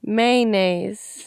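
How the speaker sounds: drawn out, each syllable stretched. Shown as a demonstration. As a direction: say "Mayonnaise" very slowly, syllable by syllable.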